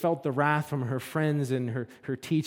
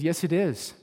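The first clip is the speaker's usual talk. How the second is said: rising, louder insistence, voice pitched higher; getting angry; 5 semitones higher, 5 dB louder than usual. Retold or imitated. Woman insisting to her counselor, louder and higher. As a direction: push back hard, she won't be told otherwise. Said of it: "And she's getting angry".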